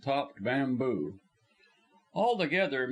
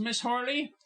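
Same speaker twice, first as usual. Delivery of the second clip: lightly higher caller; a polite call at the door; caller's voice; 10 semitones higher than usual. Acted. Visitor calling for the actress, lightly voiced.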